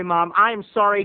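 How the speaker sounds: ordinary delivery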